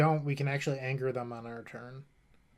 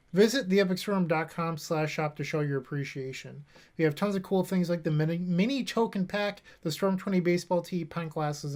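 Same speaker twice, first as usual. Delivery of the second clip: higher, louder, brisk sponsor pitch; upbeat ad read; 3 semitones higher, 5 dB louder than usual. Acted. Streamer plugs his shop to viewers, upbeat ad read. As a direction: sell the shop like a radio spot. Sponsor plug while waiting.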